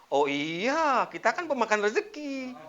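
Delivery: loud, high, breezy agreement; cheerful, smiling answer. Acted. Mother answering her daughter's question again, loud smiling cheer. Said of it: "dijawab dengan intonasi yang berbeda".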